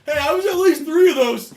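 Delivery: high, very loud shout; joking protest. Real, not acted. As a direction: holler it over everyone's laughter. Shouting over laughing table.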